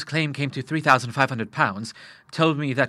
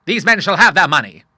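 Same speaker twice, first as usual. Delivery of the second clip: loud, high firm promise; father pledges to pay his son's creditors; firm vow; 5 semitones higher, 9 dB louder than usual. A narrator's reading. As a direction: declare it like an order. Admiral vowing.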